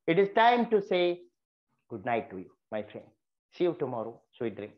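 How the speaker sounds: tuck them in with your words; with feelings. Shown as a demonstration. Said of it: "if you want to bring feelings, always say like this".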